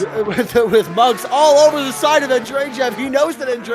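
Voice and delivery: loud, high motorsport commentary; fired-up race commentator; excited play-by-play. Real, not acted. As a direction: shout the chase as it unfolds. Calls the drift battle live.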